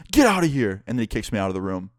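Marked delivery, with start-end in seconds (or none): 0.1-0.8 high-pitched irate order; mock angry yelling. Professor kicking intruder out of class, mock angry yelling. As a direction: imitate a fed-up professor throwing someone out. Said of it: "he's yelling at me still".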